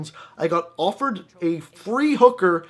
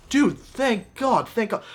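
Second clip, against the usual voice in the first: relieved; 6 semitones higher, slower than usual. Acted. Acted relief at being rescued.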